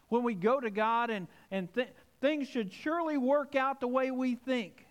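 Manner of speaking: rising preacher cadence; preaching. Acted. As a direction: drive the list home from the pulpit. Preaching builds in intensity.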